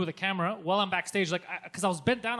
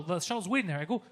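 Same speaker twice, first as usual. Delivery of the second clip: normal talking voice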